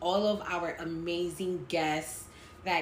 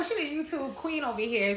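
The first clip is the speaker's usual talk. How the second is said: ordinary talk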